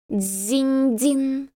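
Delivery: high ringing bell sound; bell chime imitation. A narrator's reading. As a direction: ring it out like a temple bell. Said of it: "запел храмовый колокол".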